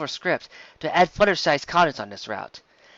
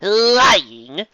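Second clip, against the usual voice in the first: high, loud indignant rise; mock innocence; 7 semitones higher, 8 dB louder than usual. A narrator's reading. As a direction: play offended innocence. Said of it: "her eyes wide".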